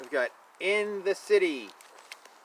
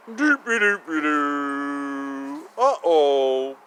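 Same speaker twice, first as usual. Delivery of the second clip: slow lower singsong then uh-oh; playful singsong; 3 semitones lower, slower than usual. Pretend. Reading to child, playful singsong and uh-oh. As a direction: hum it playfully.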